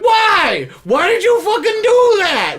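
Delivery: high, loud panicked yell; shouting. Retold or imitated. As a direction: yell in outrage. Characters yelling at girl.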